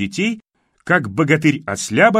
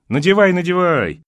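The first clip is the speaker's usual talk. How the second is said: generously permitting; 7 semitones higher, 5 dB louder than usual. A narrator's reading. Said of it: "великодушно разрешил Витюшка".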